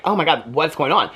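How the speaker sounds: normal talking voice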